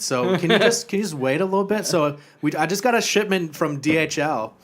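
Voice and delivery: high, loud, camp foreign henchman; chummy, hammed-up henchman voice; Tommy Wiseau-like accent. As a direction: explain eagerly in a lilting put-on accent. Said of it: "kinda sound like Tommy Wiseau".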